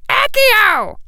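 very high, loud squeaky cry; high-pitched urgent incantation; cried-out spell. A narrator's reading. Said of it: "was already crying".

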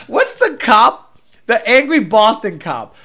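normal talking voice

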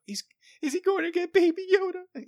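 imitating daughter. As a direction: ask it like an eager little girl. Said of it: "she's like".

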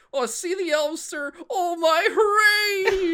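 very high, hammed-up hobbit cheer; cartoon Sam. Podcaster quoting the hobbit thrilled about elves, high mock-whining cheer. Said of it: "Sam says".

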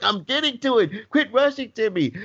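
high, whiny-sounding retold protest; defensive kid. Son answering impatient dad on phone, defensive. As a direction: imitate a son pushing back at his hurrying dad. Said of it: "The kid's like".

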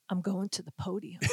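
soft, low, laughing confidence; secretive laughing hush. Retold or imitated. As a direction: let her in on a secret while chuckling.

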